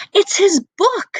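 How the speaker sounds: high, small voice of a book; oldest book friend. Acted. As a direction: squeak it out like a tiny old book. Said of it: "said his oldest friend".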